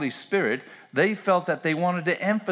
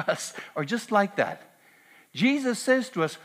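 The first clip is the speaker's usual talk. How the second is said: fervent preaching; 5 semitones higher than usual. Acted. Pastor urging the congregation not to forget, impassioned preaching. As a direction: plead with the church to remember.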